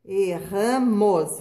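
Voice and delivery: erramos with the double R drawn out hard and slow; strong trilled R. Shown as a demonstration. Teacher to pupils, slowly stressing the strong rr sound. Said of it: "que se escreve com dois R's".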